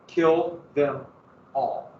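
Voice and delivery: normal talking voice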